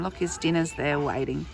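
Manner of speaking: ordinary delivery, nothing marked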